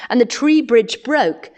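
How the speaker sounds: usual speaking voice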